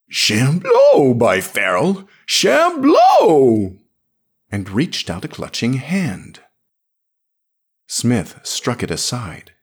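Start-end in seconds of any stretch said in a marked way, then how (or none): 0.1-3.8 put-on loud, much higher drunken bawling. A narrator's reading. Drunk Drylander bawling at the girl in the street. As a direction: bawl it out, lurching drunk.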